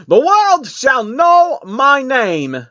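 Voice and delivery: high, loud, very slow proclamation; grand proclamation. A narrator's reading. The queen vows the world will know her, loud and slow. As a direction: boom out your name to the world. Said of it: "she proclaimed".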